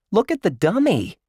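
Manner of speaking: put-on high pointing-out kid voice; excited kid. A narrator's reading. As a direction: spot something fun. Josh pointing out the dummy.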